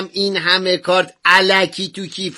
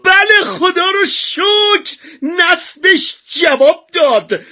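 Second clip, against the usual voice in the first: sarcastic; 9 semitones higher, much slower than usual. Acted. Mock cheer at news.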